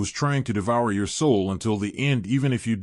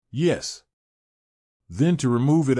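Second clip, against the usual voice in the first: ordinary delivery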